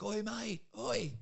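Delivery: high, hammed-up Australian accent; cheeky Australian accent hailing the ballplayers; played-up Australian accent. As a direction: lay the Australian accent on thick, calling across the field. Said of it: "play on the Australian accent a bit".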